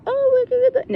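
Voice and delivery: sing-song fake sweetness; mocking sweet voice. Mimicking those who say we're good, high and mocking. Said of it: "They'll tell you".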